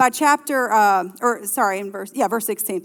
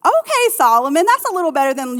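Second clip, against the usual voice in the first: amused; 7 semitones higher, 6 dB louder, faster than usual. Real, not acted. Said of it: "amused remark".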